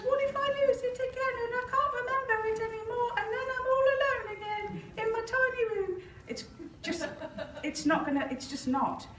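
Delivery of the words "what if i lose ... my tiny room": much higher, small and anxious inner voice; tiny little voice. Retold or imitated. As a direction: voice a scared little inner child, pitched way up. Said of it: "tiny little voice that goes".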